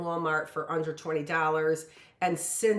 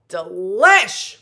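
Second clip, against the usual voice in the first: "Delish!" called out much louder with relish; excited exclamation; 2 semitones higher, 14 dB louder than usual. Pretend. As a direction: shout out "Delish!" as if the taste thrilled you.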